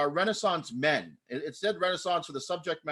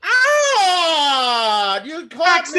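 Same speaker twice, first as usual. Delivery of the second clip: high, loud wail in character; hammed-up cry of being caught; mock wail; 11 semitones higher, 12 dB louder, much slower than usual. Caught in a fairy-tale scene.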